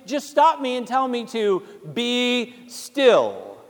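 usual speaking voice

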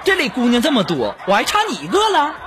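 mock-offended comeback; huffy defiance. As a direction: brush off a rejection, voice climbing in protest. Huffy retort after being rejected.

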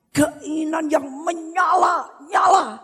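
high-pitched, building exhortation; fiery preaching. Real, not acted. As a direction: stretch key phrases, urging the congregation on. Preaching at full force.

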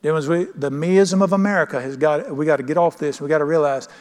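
ordinary talk

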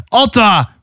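high falling name call. Acted. Haran calls Atta away from the mammoth, calling out. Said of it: "hoorde ze Haran roepen".

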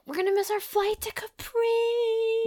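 very high, drawn-out mimicking wail; mock dramatic wail. Recapping the minions fretting over the flight to Capri, mock wail. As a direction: imitate them wailing it, high, loud and stretched out.

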